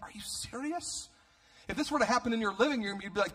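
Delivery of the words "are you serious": very high, soft, drawn-out disbelief; incredulous. Retold or imitated. Answering people who call it beautiful, acted incredulity. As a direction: question it in a slow, squeaky-high hush. Incredulous reply to admirers of story.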